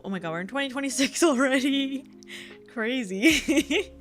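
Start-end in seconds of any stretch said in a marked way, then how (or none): none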